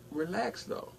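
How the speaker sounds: quiet calm. Retold or imitated. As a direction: hush her down gently. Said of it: "I said it calm".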